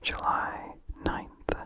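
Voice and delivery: usual speaking voice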